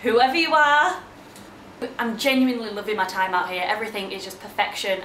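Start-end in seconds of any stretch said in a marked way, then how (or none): 0.0-1.0 mock gushing back. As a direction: gush back at a stranger. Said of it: "And I'm like".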